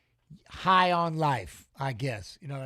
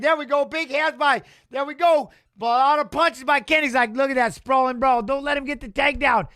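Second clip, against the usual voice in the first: higher and louder play-by-play; hyped commentary; 6 semitones higher, 6 dB louder than usual. Real, not acted. Streamer hyping his fighter's punches, excited and loud. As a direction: call the fight like it's huge.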